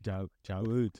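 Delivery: hammed-up Scouse, mocking the pundit; mock Scouse accent. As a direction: take off a Liverpool pundit's Scouse accent saying Giroud. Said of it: "Bloody Scouse accent".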